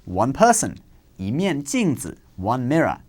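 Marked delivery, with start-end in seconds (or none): 1.2-2.1 yi in Yi ge ren rising, second tone. Shown as a demonstration. Teacher demonstrating to learners, yi rising. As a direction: lift yi upward in Yi ge ren. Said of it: "it changes to the second tone".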